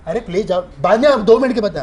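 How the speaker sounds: loud, high hurry-up; staged scolding to cut the call short; shooing spot boy. Retold or imitated. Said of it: "चिल्ला रहा हूँ".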